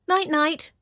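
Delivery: put-on high little-boy voice; little boy's voice. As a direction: say it like a small child. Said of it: "answers a familiar little voice".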